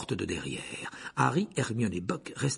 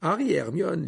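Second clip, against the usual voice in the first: usual speaking voice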